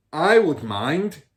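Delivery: a loud, slow, curt refusal; snapped. A narrator's reading. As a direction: bark it at her, slowly. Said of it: "he snapped".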